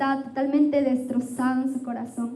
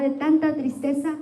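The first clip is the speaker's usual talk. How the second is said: usual speaking voice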